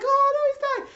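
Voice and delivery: very high mock wail; mock panicked friends. Friends whimper over the dying hero, very high mock wail. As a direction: whine it like useless onlookers. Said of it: "they're just like".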